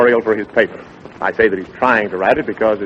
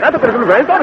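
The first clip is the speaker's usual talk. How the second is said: higher put-on voice for the figure; voiced character; 11 semitones higher, much faster than usual. Dick voices a character in his garbled lion tale. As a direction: pitch up for the king's man.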